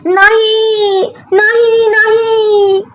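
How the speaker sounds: high, loud, drawn-out panicked cry; loud shout. Acted. Young rabbit crying out in panic, very high and loud. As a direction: scream no like he's in a fright. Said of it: "ससोबा जोरात ओरडला".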